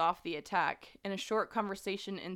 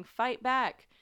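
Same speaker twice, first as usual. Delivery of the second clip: high, loud shouted command; urging a partner to fight; retold yell; 6 semitones higher, 8 dB louder than usual. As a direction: yell it over the storm. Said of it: "clearly hear Amy yelling".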